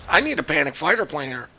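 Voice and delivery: ordinary delivery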